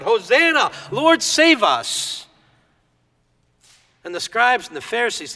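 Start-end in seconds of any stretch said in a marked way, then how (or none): 0.0-2.3 higher, louder, slow hosanna call; crying out. Retold or imitated. Children hailing Jesus, slow joyful cry. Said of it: "the children were crying out".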